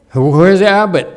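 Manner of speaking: a rich man who expects service; demanding call. Acted. Rich merchant demanding the abbot, loud and high.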